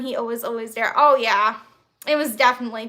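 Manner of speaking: ordinary talk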